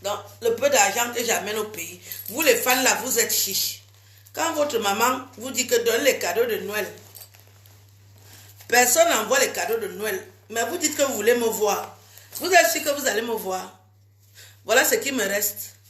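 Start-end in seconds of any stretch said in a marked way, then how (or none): none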